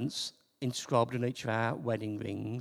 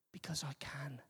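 whispered; 2 semitones lower, 14 dB quieter than usual. Real, not acted. Whispered punchline.

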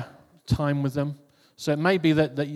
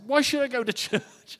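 laughing protest; 10 semitones higher than usual. Retold or imitated. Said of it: "I said".

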